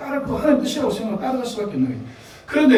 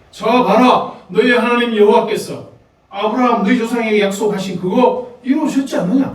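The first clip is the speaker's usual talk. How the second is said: Moses exhorting; 4 semitones higher, 6 dB louder than usual. Acted. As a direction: proclaim it as Moses rallying the people. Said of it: "이 모세가 창세기를 기록해서 그들에게 들려주는 거죠". (모세가 이스라엘 백성에게 크게 외치듯 천천히)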